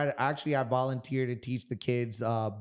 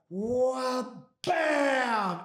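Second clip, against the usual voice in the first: much higher shouted strike cry; battle yell; 12 semitones higher than usual. Retold or imitated. Fighter reenacting his thunderous yell at the gym, high shout. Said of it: "And I went".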